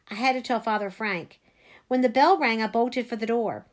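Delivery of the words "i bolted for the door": ordinary delivery, nothing marked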